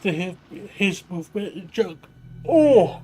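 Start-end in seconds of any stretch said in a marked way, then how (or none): 2.5-3.0 a big, loud yawn mid-sentence. Real, not acted. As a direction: let out a long yawning groan. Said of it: "sorry, yawn".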